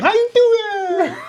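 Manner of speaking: high, loud, drawn-out fake Chinese accent; mock Chinese accent. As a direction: stretch it out in caricatured Chinese. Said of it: "What about the Chinese?".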